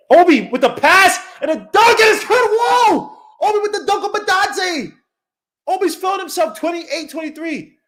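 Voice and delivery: high, loud play-by-play yell; excited shout. Real, not acted. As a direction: scream the dunk call. Dunk called live.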